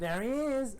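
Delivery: high-pitched, sing-out call; playful high call. Pretend. Player spotting something in his game, playful high call.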